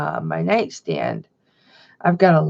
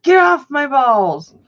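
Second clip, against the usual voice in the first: mock snap; 7 semitones higher, 5 dB louder, slower than usual. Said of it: "I'll be like".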